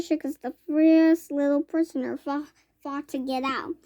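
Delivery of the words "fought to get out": ordinary delivery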